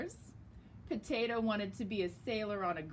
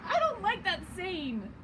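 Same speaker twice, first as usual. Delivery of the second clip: voiced Cheese; 9 semitones higher, 6 dB louder than usual. Cheese offended.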